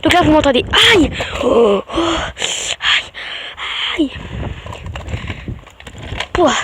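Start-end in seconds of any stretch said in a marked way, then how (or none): 0.9-4.1 sharp cries of pain; real little yelps of hurt; pained ouch. Real, not acted. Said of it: "Ça m'en fait mal".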